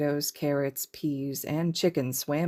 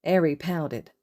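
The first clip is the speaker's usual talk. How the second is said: usual speaking voice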